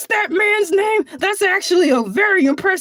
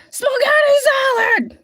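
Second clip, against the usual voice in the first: outraged cry; 7 semitones higher than usual. Real, not acted. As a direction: shout it in disbelief. Outraged at brother's cooking.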